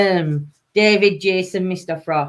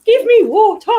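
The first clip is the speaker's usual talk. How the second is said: mock demand; 7 dB louder than usual. Jokey demand for water.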